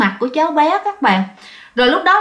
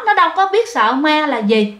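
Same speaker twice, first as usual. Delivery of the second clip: ordinary delivery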